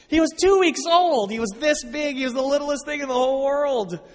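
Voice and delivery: high, loud burst of disbelief; impassioned emphasis. Real, not acted. Stressing newborn's tininess in disbelief.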